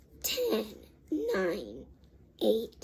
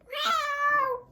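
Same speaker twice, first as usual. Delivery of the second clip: high, drawn-out falling meow; a fed-up little girl meowing; daughter's grumpy meow. Retold or imitated. Daughter meowing at her mom because she is mad. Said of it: "hates me and she's like".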